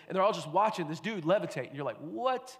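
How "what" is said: high, drawn-out disbelieving "what"; incredulous. Acted. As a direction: react like a stunned onlooker. Stunned onlooker at a levitation.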